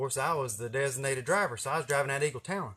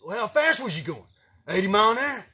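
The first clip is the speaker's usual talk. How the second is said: father; 8 semitones higher, 7 dB louder than usual. Retold or imitated. Father questions, louder.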